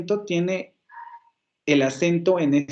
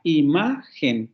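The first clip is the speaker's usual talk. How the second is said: "Imagen" split slowly into i, ma, gen; syllable by syllable; much slower than usual. Shown as a demonstration. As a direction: stretch "Imagen" out, leaning on MA. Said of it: "hacer otra vez la división silábica".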